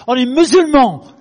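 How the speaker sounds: loud mock challenge in French; confrontational French. Big man poking a pastor in the chest, loud challenge. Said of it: "poked me in the chest and said in French".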